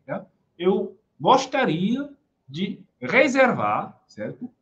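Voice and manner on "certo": ordinary talk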